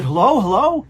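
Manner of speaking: high, loud imitation of an anxious caller; retold frantic greeting. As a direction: call urgently into the phone, acted out. Frantic wife answers the phone.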